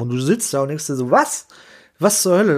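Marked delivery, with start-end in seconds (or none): 1.1-2.6 sudden high outraged "what?!"; shocked disbelief. Retold or imitated. Consultant thinking about the client's cuts, in shocked disbelief. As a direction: jump up in pitch in disbelief. Listener's shocked inner reaction.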